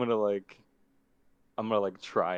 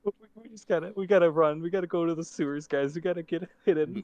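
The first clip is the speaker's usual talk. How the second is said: put-on much higher, panicked young creature; frightened kid voice; 10 semitones higher, 12 dB louder than usual. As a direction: stammer in terror. Mister B voice.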